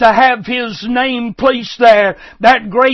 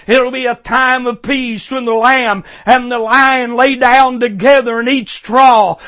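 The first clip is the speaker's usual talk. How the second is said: normal talking voice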